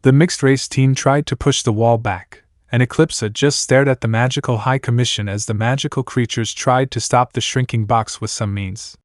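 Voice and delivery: ordinary delivery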